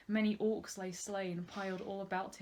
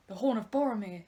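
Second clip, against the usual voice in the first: a touch of a cry, a bit louder; mild read-aloud cry; 7 dB louder than usual. A narrator's reading. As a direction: call it out lightly. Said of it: "he cried".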